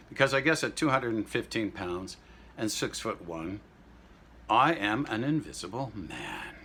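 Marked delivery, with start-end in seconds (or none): none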